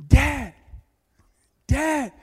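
high, loud calling out; retold cry for Dad, pitched high and loud; yelling for dad. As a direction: call out as a trapped daughter. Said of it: "she was yelling".

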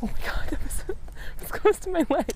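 laughing with relief, breathy and high; relieved laughter. Real, not acted. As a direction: laugh it off after a close call. Hook swung near her leg.

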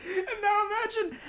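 high-pitched words squeezed out through laughter; helpless laughing. Real, not acted. Host picking up the story after the punchline, helpless laughing. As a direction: try to carry on while cracking up.

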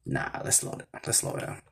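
low, unvoiced murmur under the breath; talking to oneself. Real, not acted. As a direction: drop to a near-whisper, as if to yourself.

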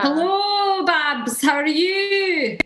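high, drawn-out Scottish lilt; Lawrence Chaney's Scottish accent. Shown as a demonstration. Contestant demonstrating Lawrence's energy, high slow Scottish accent. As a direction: sing out each phrase high and Scottish. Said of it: "I feel like Lawrence says".

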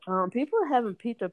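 usual speaking voice

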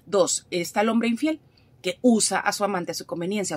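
ordinary talk

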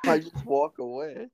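real laughter spilling into the words; high, breaking into helpless laughter; cracking up. Real, not acted. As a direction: struggle to go on through giggles. Cracks up at own crying story.

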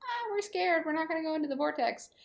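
high, quick frightened whine; mock scared. Podcaster mimicking Lucas and Marshall refusing to help, mock scared. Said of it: "who are basically like".

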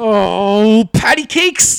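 high prissy "oh, patty cakes"; mock a goody-goody swearing politely; mock prim exclamation. Teasing fake swearers with a high prissy voice. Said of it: "the fake swears instead of just".

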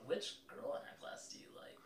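put-on soft, timid nerdy boy; lightly voiced, soft and shy; shy kid voice. GM voices shy classmate Paul.

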